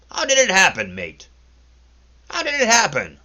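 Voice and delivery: high, loud mocking question. A narrator's reading. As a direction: needle him, teasing. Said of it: "less brutal and more mocking".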